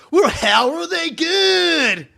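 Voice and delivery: high, very loud, drawn-out outraged shout; Vernon's mad rant. Retold or imitated. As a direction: imitate a riled-up podcaster, yelling slowly. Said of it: "He was getting so mad".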